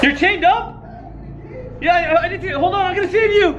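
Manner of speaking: high, alarmed calling; urgent shout. Acted. Promising to save a chained friend, urgent high shout. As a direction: shout reassurance through a wall.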